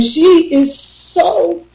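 words catching on real tears; real tearful break starting; crying. Real, not acted. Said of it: "I didn't mean to cry".

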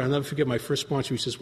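ordinary delivery

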